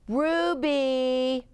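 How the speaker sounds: high, drawn-out warning call. A narrator's reading. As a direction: call the name out long, as a warning. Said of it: "the boys warned".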